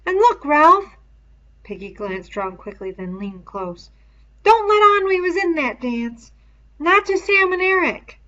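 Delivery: put-on high, loud boyish voice, urgent; Piggy's high pleading voice. A narrator's reading. Piggy leans close, conspiratorial.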